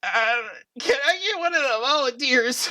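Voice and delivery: nervous dork's high, wobbly plea; high-pitched short dork on the verge of tears; dorky, near tears. Acted. Timid contestant addressing the judges, high and tearful. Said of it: "on the verge of tears".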